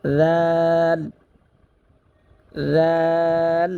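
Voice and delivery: জাল with tongue tip on upper teeth, drawn long; letter demo. Shown as a demonstration. Teacher demonstrating জাল for students, drawn out. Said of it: "জালটা উচ্চারণ করব তেরো নম্বর মাখরাজ হতে".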